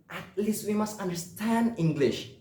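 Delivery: ordinary talk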